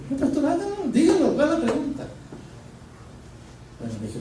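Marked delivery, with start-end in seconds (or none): 0.1-2.0 much higher, laughing plea; laughing insistence. Retold or imitated. Student laughing as he presses the pastor for the question. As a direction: laugh while pressing him to tell.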